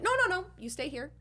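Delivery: high, quick mock protest; retold fending off. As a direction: rattle off a high-pitched keep-back. Acting out fending off his grab.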